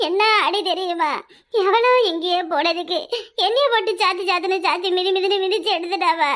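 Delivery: normal talking voice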